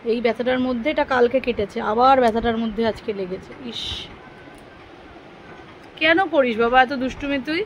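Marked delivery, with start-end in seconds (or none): none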